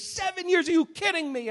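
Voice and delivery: high-pitched incredulous protest; indignant disbelief. Retold or imitated. Preacher as Jacob protesting to Laban, indignant disbelief. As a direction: jump up in pitch, aghast.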